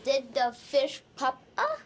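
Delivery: up rising at the end; Did the fish puff up? with up rising. Shown as a demonstration. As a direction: let the voice climb on up. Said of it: "Your voice comes up on up".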